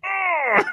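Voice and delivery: high-pitched cry of dismay; mock anguished wail. Retelling the manager's reveal, mock dismayed wail. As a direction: let out a high, exasperated "oh".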